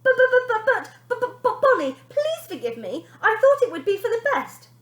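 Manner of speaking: very high, loud stammering plea; mother begging her daughter; trembling with fear. Acted. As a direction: beg while shaking with fear. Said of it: "trembling with fear".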